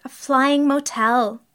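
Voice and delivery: high, loud, slow and grand; excited. A narrator's reading. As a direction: savour each word with loud wonder. Jerry thrilled by the deal.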